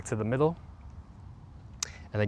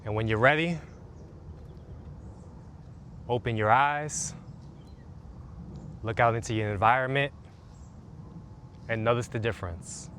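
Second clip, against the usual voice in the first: guided meditation; 2 semitones lower, 3 dB quieter than usual. Acted. Coach leading listeners through a calming routine, soothing meditation voice. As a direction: guide them gently into the body.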